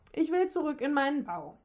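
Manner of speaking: hoffnungsvoll. A narrator's reading. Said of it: "fragte er hoffnungsvoll". (hoffnungsvoll und etwas höher gefragt)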